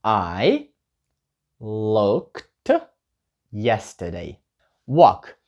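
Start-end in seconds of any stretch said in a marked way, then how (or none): 0.0-4.4 slow, word-by-word answer; slow model answer. Shown as a demonstration. Teacher modelling the short answer slowly. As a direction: lay out each word for learners. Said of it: "скажіть просто".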